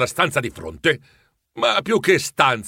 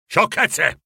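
irritated scoff; 6 semitones higher than usual. A narrator's reading.

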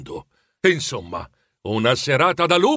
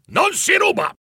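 much higher, shouted in a strange voice; furious shout. A narrator's reading. Scrooge shouts his final warning at Bob. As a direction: bellow it at the clerk.

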